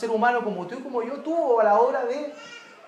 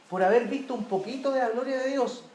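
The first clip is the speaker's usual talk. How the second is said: ordinary talk, nothing marked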